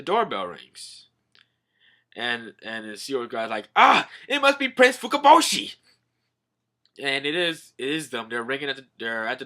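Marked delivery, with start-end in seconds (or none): 3.8-5.8 high, loud exclamation; mock alarmed announcement; excited cry. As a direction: burst out with it, much higher and louder. Acted guard shouts at doorbell.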